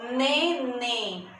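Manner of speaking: ne-ném as two held beats; split into syllables. Shown as a demonstration. Teacher showing two syllables in neném, sounded out separately. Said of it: "Duas sílabas também".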